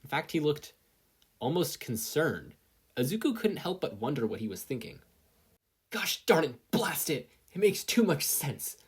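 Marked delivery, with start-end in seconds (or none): 5.9-8.8 loud, high frustrated outburst, slowed on too much sense; inner scream. Acted. Katsuki screaming in his head at the news, loud and high. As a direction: rage at yourself inside your head. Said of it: "Katsuki mentally screamed".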